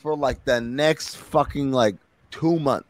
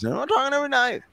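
much higher, slightly soft mock voice; mock weird high voice, earnest; 12 semitones higher, 4 dB quieter, faster than usual. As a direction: repeat it in that odd high voice.

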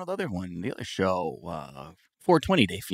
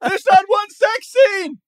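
yelling exec; 10 dB louder than usual. Acted.

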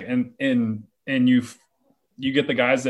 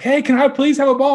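put-on much higher, louder sweet kid voice; polite little kid; 13 semitones higher, 6 dB louder, faster than usual. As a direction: ham up an overly polite kid begging sweetly for a ball. Polite kid asks for ball.

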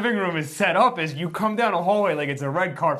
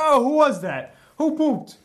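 higher, louder teasing call; mock accuser, higher and louder; mock nosy boyfriend; 9 semitones higher, 5 dB louder, slower than usual. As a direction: imitate him calling out the room. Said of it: "he's like".

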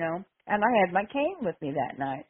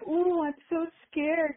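high, drawn-out mock-scared taunt; mock fear; 10 semitones higher than usual. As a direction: tease him with exaggerated fake fright. Taunting a wrestler in retold scene.

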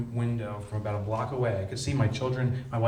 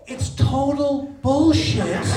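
scornful punchline; 9 dB louder, much slower than usual. Real, not acted. Debunking the rock bottom saying.

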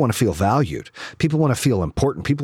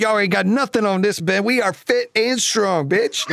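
mock taunt; 7 semitones higher than usual.

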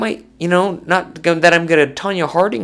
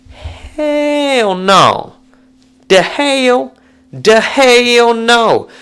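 much higher, drawn-out "hell no"; emphatic refusal; 8 semitones higher, 5 dB louder, much slower than usual. Real, not acted. Answering a fan's question about [name], a high drawn-out "hell no". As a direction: stretch out the "hell no", outraged.

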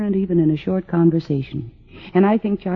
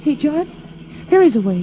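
sudden excitement; 6 semitones higher than usual. Acted. Wife hits on an idea.

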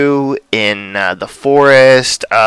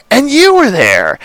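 pitched up, a bit louder, ends falling; higher dramatic take; 11 semitones higher, 3 dB louder than usual. Retold or imitated. Recapping Emma's dream to podcast cohosts, higher theatrical repeat. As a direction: lift the last repeat into a theatrical flourish. Reciting the Wizard of Oz line.

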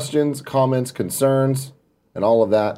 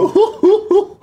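high, breathy hooting giggle; mom's laugh; 4 dB louder than usual. Retold or imitated. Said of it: "stop doing your mom's laugh".

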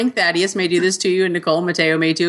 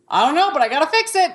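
put-on child's voice; 10 semitones higher than usual. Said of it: "And he goes".

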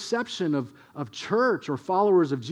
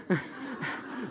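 soft breathy nervous giggle; nervous laugh; 9 dB quieter than usual. Real, not acted. Preacher recalling childhood thrill of stealing, soft nervous laugh. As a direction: let a little nervous laugh slip.

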